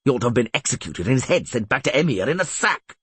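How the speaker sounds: indignant rant. A narrator's reading. Bronibor rails at Foltest's long debating, indignant. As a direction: fume with impatient questions. Bronibor rants at the closed doors.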